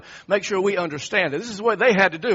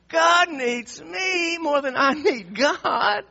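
high, drawn-out self-important proclamation; prideful boast; 7 semitones higher, 5 dB louder, slower than usual. Acted. Preacher voicing a proud king who thinks God needs him, boastful. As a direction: crow it like someone who thinks he's irreplaceable.

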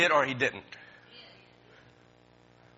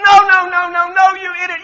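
loud, much higher shout; yelling at the TV; 19 dB louder than usual. Retold or imitated. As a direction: shout the preacher down in scorn. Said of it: "I'm yelling at the television".

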